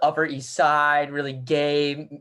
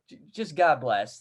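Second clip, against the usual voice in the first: ordinary delivery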